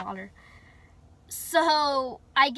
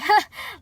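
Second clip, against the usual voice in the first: short, loud re-enacted laugh; quick laugh of someone caught out; nervous laugh; 8 semitones higher, 9 dB louder than usual. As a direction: burst out a high mock laugh. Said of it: "I just start laughing".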